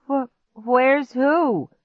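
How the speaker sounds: high, slow, confused stutter; stammering. A narrator's reading. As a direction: stumble on the first word, baffled. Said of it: "Clark stammered".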